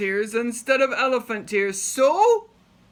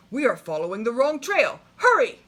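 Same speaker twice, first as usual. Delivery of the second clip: faster, rising to a high loud hurry; urgent alarm; 4 semitones higher, 6 dB louder, faster than usual. Acted. Big Max warning the king they are lost, urgent. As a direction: push them to hurry.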